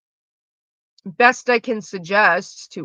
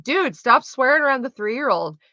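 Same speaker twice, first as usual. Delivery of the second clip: 1 exasperated telling-off; 6 semitones higher, 5 dB louder than usual. Retold or imitated. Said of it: "I'm like".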